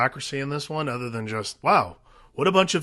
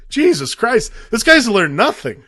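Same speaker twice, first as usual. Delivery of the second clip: high, loud exasperated outburst; exasperated reaction; 7 semitones higher, 5 dB louder than usual. Retold or imitated. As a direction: act out fed-up disbelief, pitched up. Retold exasperated reaction to movie.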